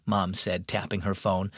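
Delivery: ordinary delivery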